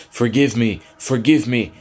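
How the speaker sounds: high, loud mocking parrot; sinister mockery. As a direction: taunt him cruelly, loud and high. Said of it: "they parroted in sinister mockery".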